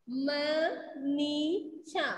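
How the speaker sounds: Manisha read very slowly, sound by sound; slow, blended reading of Manisha; slow blending. Shown as a demonstration. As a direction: blend Manisha syllable by syllable, drawn out. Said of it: "एक साथ मिला के पढ़ेंगे".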